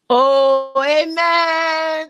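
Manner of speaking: loud, drawn-out church-style amen; fervent amen. Real, not acted. Speaker agreeing with a sister, fervent amen.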